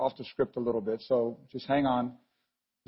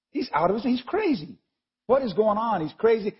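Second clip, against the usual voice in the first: higher-pitched, worked-up exclaiming; mock alarm; 7 semitones higher than usual. Preacher voicing Jesus' alarmed family to the congregation, mock alarm. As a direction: voice relatives who think he has lost it.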